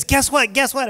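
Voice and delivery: imitating the excited wife calling with news. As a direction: blurt it out like a thrilled wife. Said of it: "She's all excited".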